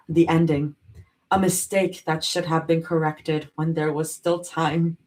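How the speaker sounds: low, measured reading voice; story narration. Acted. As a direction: read it like a dark novel, steady and low. Reads fanfic chapter aloud.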